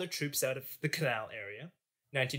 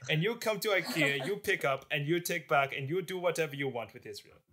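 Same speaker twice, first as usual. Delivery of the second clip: light put-on Russian accent; a lightly hammed-up Soviet diplomat pitching a deal; mild mock Russian accent; neutral Soviet accent; 3 semitones higher than usual. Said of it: "This is a neutral Soviet accent".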